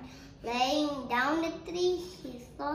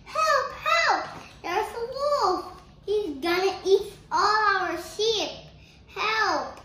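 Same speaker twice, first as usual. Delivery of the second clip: high, loud acted alarm call; shepherd boy's mock panic shout; shouting for help; 7 semitones higher, 8 dB louder than usual. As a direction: cry wolf at full voice. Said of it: "start shouting".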